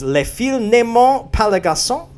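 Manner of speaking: amateur French accent, read out carefully. Shown as a demonstration. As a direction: read the line in rough schoolroom French. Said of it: "Apologies to any French viewers for that accent".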